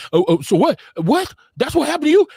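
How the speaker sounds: high, mincing gossip voice mocking a man; mock-feminine gossipy squeal; mocking effeminate voice. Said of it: "you're looking super feminine".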